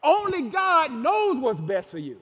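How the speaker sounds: ordinary talk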